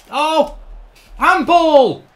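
high, loud outcry; shouted appeal. Real, not acted. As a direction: yell for the referee's attention. Appealing for a handball.

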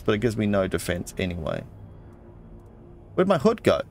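ordinary talk, nothing marked

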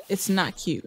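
low, matter-of-fact "It's not cute"; stern scolding. Real, not acted. Player scolds characters playing around, low and stern. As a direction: say it low, dropping the whine.

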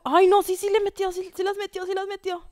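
high, loud burst easing in volume; high-pitched startled play-by-play; shocked excitement. Real, not acted. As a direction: cry out in alarm, then keep it high. Shock at a hit in match.

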